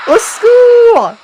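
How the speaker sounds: very high, loud, drawn-out shriek; screamed cry for help. Acted. Victim calling for help against ghost, long high scream. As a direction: shriek for rescue in acted terror.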